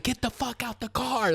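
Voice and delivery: high-pitched mock yell at normal volume; retold yell. Storyteller imitates the man ordering them out, mock yell. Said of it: "like, yelling at us".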